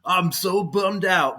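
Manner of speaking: mock bummed out. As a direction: drag it out like a sad sack pretending to care. Imagined disappointed inner voice.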